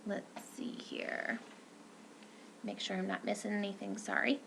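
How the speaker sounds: whispered, fast aside to herself. Real, not acted. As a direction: whisper while checking notes. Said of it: "aside while checking notes".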